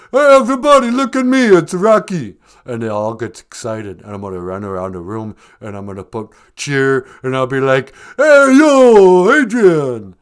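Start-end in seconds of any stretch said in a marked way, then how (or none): 0.1-2.4 loud, high boxer holler; calling out. Acted. Film star announcing himself at a pizza place, hollering. 8.2-10.2 long, high hollered catchphrase; calling out. Acted. Film star doing his movie catchphrase, drawn-out yell.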